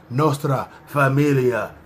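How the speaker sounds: "Nostra Familia" drawn out, Spanish-style; "Familia" as a slow fah-MEE-lia; Spanish-style pronunciation. Shown as a demonstration. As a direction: say "Nostra Familia" slowly, as members do. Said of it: "I keep saying it like that".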